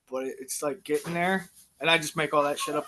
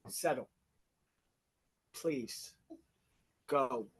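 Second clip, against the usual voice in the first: hushed firm command; 6 semitones lower, 10 dB quieter, much slower than usual. Real, not acted. Dad hushing daughters.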